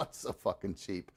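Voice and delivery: usual speaking voice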